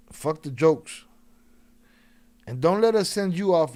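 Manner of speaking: loud, pushing him to get serious; forceful call-out. Real, not acted. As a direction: raise your voice and cut the jokes. Pressing the caller to speak.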